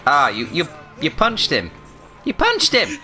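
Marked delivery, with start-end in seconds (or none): 2.2-3.0 much higher squeaky repeat; mock squeal. Gamer mocks his teammate's punch in a squeaky high voice. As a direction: squeak it back like a cartoon.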